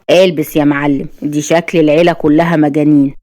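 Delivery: plain reading voice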